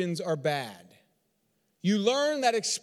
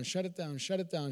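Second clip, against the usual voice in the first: soft, quick self-suppression; hushed repetition; 9 dB quieter than usual. Acted. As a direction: push it down under your breath. Voices repeated inner suppression.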